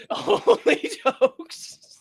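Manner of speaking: high, stretched-out words through laughter; drawn-out laughing. Real, not acted. Host joking after crude jokes, drawn-out laughing. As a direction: draw it out, high and slow, laughing. Repeats the phrase while laughing.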